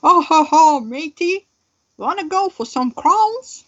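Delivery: put-on a botched British accent that drifts French; bad British accent. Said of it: "do more British accent".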